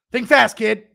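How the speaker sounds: loud, high shout; shouting think fast at a kid. Acted. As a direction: throw it at him by surprise. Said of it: "Impression of Chandler at the adoption agency".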